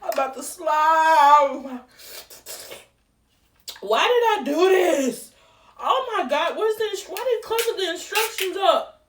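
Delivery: half-sung distressed cry; tearful wail. Real, not acted. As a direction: wail through the tears. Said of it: "I'm literally in tears".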